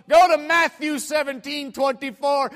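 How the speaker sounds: loud, high heckling command; mock drunk stranger. Stranger challenging preacher with a verse, shouted. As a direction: challenge him like a rowdy heckler, acted out. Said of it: "I got a drunk on my hand here".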